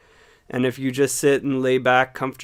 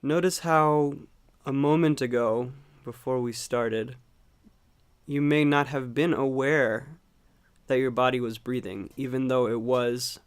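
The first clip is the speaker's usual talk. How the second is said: calm meditation guide, long unhurried pauses; guided meditation; 4 dB quieter than usual. Acted. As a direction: gently guide them into watching the breath, leaving space between phrases. Leads a breathing meditation.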